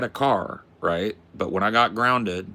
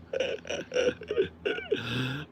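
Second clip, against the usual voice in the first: high laugh; 9 semitones higher, 3 dB quieter than usual. Real, not acted. Laughs at the yawn effect.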